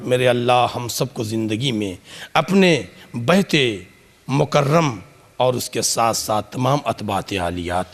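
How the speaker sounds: low, hushed supplication; closing prayer. Acted. (धीमी, दबी आवाज़ में दुआ)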